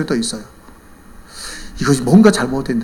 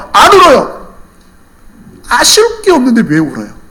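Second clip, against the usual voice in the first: high, loud sermon delivery; impassioned preaching; 9 semitones higher, 8 dB louder than usual. Real, not acted. Preacher admonishes congregation about not praying, impassioned. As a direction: drive the lesson home at full force. Preaches the lesson at full force.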